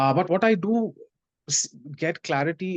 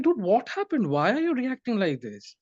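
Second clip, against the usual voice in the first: startled disbelief; 7 semitones higher than usual. Retold or imitated. Startled by friend's panic.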